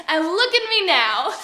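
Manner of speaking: loud, dramatic self-pity; indignant lament. Acted. Actress laments her career to the audience, loudly indignant. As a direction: cry out in wounded professional pride.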